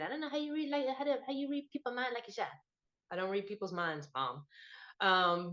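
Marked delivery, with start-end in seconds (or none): 0.0-2.5 softer, disapproving mom impression; imitating mom. Daughter quoting mom on her card reading, mock-disapproving mom voice. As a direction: do your mother's puzzled complaint, quick and quiet. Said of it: "as my mom puts it".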